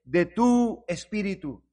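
loud, slow, emphatic model prayer; fervent prayer. Acted. Preacher showing congregation how to ask for the Spirit, fervent prayer. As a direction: plead with God at full voice. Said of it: "Tenemos que orar y decirle".

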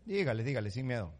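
ordinary talk

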